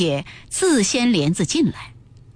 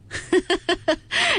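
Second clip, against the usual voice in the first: a high rising laugh; amused laugh; 8 semitones higher than usual. A narrator's reading. Xifeng laughs at her naive maid, amused laugh. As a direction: laugh at the silly girl. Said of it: "凤姐笑道".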